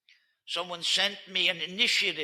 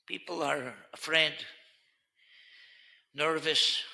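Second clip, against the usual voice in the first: ordinary delivery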